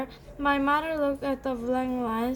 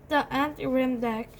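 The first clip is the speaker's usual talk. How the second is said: ordinary delivery, nothing marked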